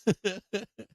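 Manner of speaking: laughing. Real, not acted. Laughs at own joke.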